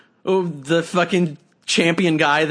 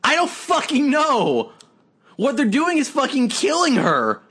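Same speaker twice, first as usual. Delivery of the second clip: frustrated exasperation; 10 semitones higher than usual. Real, not acted. Frustrated rant.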